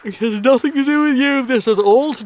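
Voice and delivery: said through a real yawn; words pulled high by a yawn. Real, not acted. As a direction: stretch it out mid-yawn. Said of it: "I'm yawning".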